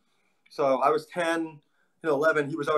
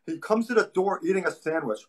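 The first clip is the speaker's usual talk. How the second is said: ordinary delivery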